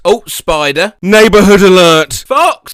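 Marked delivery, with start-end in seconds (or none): 1.0-2.1 louder mock announcer; announcer call. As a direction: announce it like an alarm. Announcing each silly alert.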